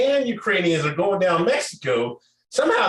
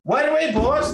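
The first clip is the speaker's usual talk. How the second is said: high, eager mock butler voice; hammed-up eager servant; mock servile reply; 11 semitones higher than usual. Mocking the president as an eager servant. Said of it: "President like".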